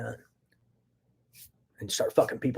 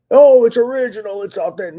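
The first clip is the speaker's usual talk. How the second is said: mocking quote; 8 semitones higher, 14 dB louder than usual. Mocking fake authenticity claims.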